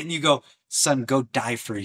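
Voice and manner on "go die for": ordinary delivery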